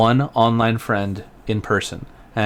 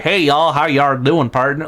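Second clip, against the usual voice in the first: higher, hammed-up Southern greeting; exaggerated Southern accent; 4 semitones higher, 3 dB louder, slower than usual. Showing a friend how they don't talk, exaggerated Southern accent. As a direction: put on a cartoonish Southern twang. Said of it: "kinda like your southern accent".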